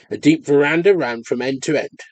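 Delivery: usual speaking voice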